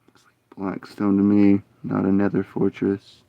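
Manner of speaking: soft whispered musing; whispering. Real, not acted. Gamer whispering to self while exploring a cave. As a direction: hush it to yourself.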